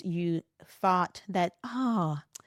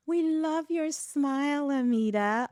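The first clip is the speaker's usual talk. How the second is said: lightly imitating a gushing colleague; a touch of fake sweetness; mock gushing compliment; 7 semitones higher than usual. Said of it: "I hear that so much".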